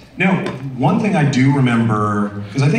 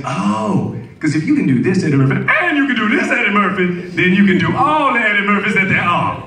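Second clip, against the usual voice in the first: high, pulled-back Eddie Murphy voice; laid-back star talking about himself; Interview Eddie Murphy; 8 semitones higher than usual. Retold or imitated. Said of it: "He did Interview Eddie".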